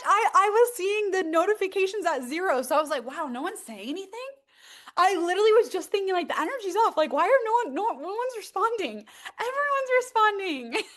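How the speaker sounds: overwhelmed with happy relief; excited. Real, not acted. Host telling viewers chat was working, excited.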